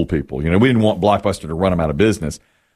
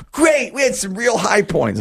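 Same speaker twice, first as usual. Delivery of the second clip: high-pitched cheery imitation; mock a relentlessly upbeat colleague; exaggerated chipper answer, pitched up; 11 semitones higher than usual.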